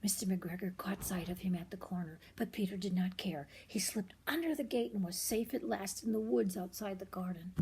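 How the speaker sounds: ordinary delivery, nothing marked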